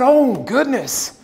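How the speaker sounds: pleading to be told it isn't so; a boy upset at his own voice on tape; dismayed. Retold or imitated. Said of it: "And I said".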